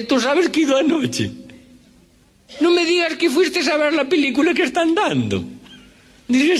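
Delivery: high-pitched Galician Spanish with lisped z sounds; Galician accent. Retold or imitated. Manuel invites Jesús to the cinema, in a high Galician accent. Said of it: "este cuento de gallegos de Galicia".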